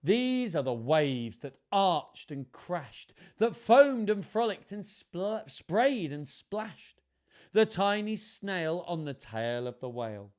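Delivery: measured, lilting picture-book reading; children's story narration. A narrator's reading. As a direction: read it to children, savouring the rhymes. Reads picture book aloud.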